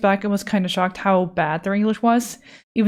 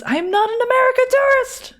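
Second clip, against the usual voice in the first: much higher, louder indignant protest; mock outrage; 4 dB louder than usual. Streamer protesting being called an American tourist, mock outrage. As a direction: shout it in hammed-up offense. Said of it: "American tourists are deaf worse".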